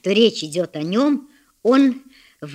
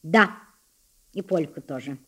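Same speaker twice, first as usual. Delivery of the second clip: restrained; 5 semitones lower than usual. A narrator's reading. Blushing boy answers his tormentor, restrained. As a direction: hold back, answering low after a pause. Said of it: "ответил сдержанным тоном".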